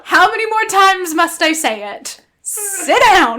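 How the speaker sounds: loud, emphatic telling-off with a laugh; mock exasperated scolding. Partner ordering the sick man to stay, loud mock exasperation. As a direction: lay down the law, hammed-up.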